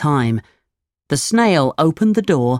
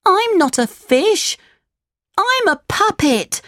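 much higher, crying out as the puppet; high puppet boy cry. Acted. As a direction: squeal it as the little wooden boy. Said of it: "cried Pinocchio".

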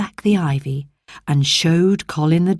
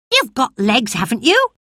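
put-on boy Dickon; 6 semitones higher than usual. Dickon teasing.

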